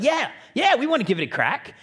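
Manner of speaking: excited high yeah; eager kids. Retold or imitated. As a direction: kids bursting to have a go.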